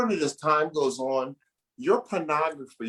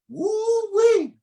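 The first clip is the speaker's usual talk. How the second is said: long, high, drawn-out holler; woo-wee; 6 dB louder than usual. Real, not acted. Host hollers about mature women, drawn out and high.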